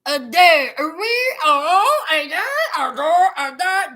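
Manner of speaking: mock garbled mumble of a country boy; lightly voiced slurred mimicry of Blaine; mumbled imitation. Mocking a reality-show boy who talks in cursive, garbled mumble. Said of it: "talking like he got two tongues".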